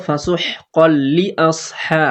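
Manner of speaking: slow ফাসুহ কল with a doubled লাম; slow recitation. Shown as a demonstration. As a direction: bounce off the ক into ল in কল. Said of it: "লি তাড়াতাড়ি পড়বো". (কল ধাক্কা দিয়ে পড়া)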